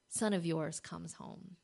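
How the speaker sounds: ordinary talk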